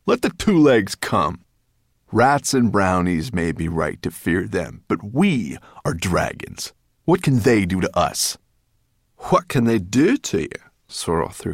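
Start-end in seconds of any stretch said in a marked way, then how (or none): 0.1-6.7 loud, proud dismissal; pompous dragon dismissing the danger; scornful. A narrator's reading. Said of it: "stout dragon scornfully". 7.1-8.4 loud, dismissive question; pompous rhetorical question from a big dragon; scornful. A narrator's reading. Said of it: "stout dragon scornfully". 9.3-10.5 higher, loud, incredulous repeat; indignant brownie mocking the dragon's question; angry echo. A narrator's reading. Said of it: "She was angry now".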